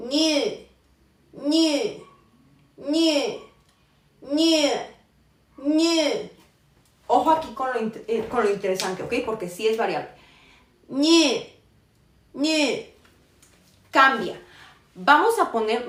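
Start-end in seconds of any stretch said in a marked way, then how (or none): 0.1-6.3 ñe high and arched, gliding I toward U; I-U blend demo. Shown as a demonstration. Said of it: "pronunciarla como si fuera una". 10.9-12.9 Ñe high, rounded and arched; I-U blend demo. Shown as a demonstration. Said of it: "pronunciarla como si fuera una".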